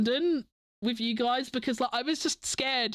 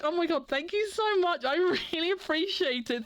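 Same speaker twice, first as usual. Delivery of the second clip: much higher, excited gushing; real excited thanks; overwhelmed thanks; 8 semitones higher than usual. Real, not acted. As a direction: gush at the generous gifter. Thanking for big gift.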